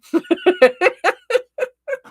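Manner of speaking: high, loud giggling laugh; real burst of laughter. Real, not acted. Reader amused by her own Southern accent, laughing. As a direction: crack up helplessly, high and loud.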